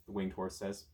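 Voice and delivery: plain reading voice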